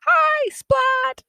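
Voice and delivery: high, loud class-wide cheer; excited high-pitched shout; yelling greeting. Acted. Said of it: "they all yell".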